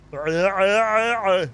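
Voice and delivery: high wavering imitation call; put-on warbling noise, rising then falling; imitated creature noise. Shown as a demonstration. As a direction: attempt a silly trilling cry. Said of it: "I forget how it is exactly".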